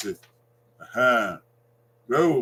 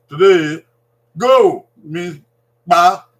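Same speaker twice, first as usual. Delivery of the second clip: loud teaching emphasis; 10 dB louder, much slower than usual. Shown as a demonstration. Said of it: "teacher drills key word".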